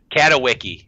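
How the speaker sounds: Katowicki with an English "wicky" ending; mangled Katowice. Real, not acted. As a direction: offer Katowicki, ending in "icky". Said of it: "that sounds even worse than mine".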